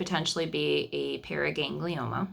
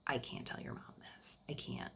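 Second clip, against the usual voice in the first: much softer, nearly whispered; hushed retold refusal; 13 dB quieter than usual. Wife to husband on the phone, unable to tell his mom, hushed. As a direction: say it under the breath, as a retold plea.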